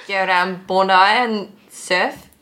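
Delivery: slow mock-Aussie drawl; Australian accent. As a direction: stretch out an Australian surfer's invitation. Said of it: "in an Australian".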